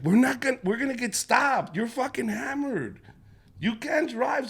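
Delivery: high, loud panicked wife; panicked mom. Retold or imitated. Wife panics at drunk husband driving, high and loud.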